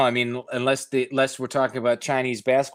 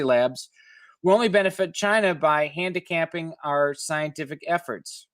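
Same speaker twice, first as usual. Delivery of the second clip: higher, measured reporter read; article read aloud; 4 semitones higher than usual. Acted. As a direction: read it like a radio feature story. Reads article text aloud.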